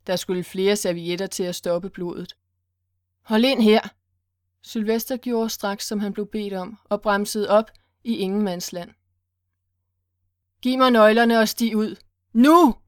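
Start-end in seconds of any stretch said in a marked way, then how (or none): 3.2-3.8 loud barked order, no hesitation; sharp command. Acted. Gunman orders the driver to pull over, loud command. 10.6-12.7 loud order, rising to a yell on the last word; commanding shout. Acted. Gunman orders Sylvester out of the car, commanding shout. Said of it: "kommanderede stemmen igen".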